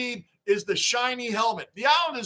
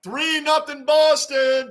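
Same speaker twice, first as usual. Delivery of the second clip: stretched, louder announcement of the score; slow loud score call; 7 semitones higher, 5 dB louder, slower than usual. Real, not acted. Streamer announcing the score to chat, slow and loud.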